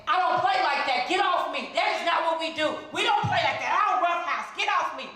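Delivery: I-don't-roughhouse indignation; indignant protest. Retold or imitated. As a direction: push back hard, offended. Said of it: "And I went".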